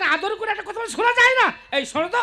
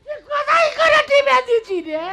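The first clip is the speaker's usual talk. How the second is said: loud crying; 5 semitones higher, 5 dB louder than usual. Acted. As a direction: bawl and lament at full voice. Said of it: "এত কান্না হলো কি?". (হাউমাউ করে কান্না)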